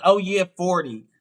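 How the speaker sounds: ordinary delivery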